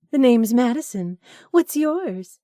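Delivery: high, loud, flirty come-on voice; flirty woman. Acted. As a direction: purr it like a man-hungry flirt. Flirty woman introduces herself.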